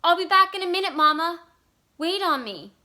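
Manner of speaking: high, loud shout into the distance. A narrator's reading. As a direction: call back loudly across the clearing. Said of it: "he shouted".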